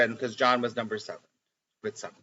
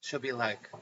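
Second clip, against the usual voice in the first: usual speaking voice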